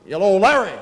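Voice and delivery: loud, booming phone-message greeting. Retold or imitated. Father leaving his son a message, loud. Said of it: "Always loud".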